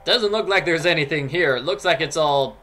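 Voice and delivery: usual speaking voice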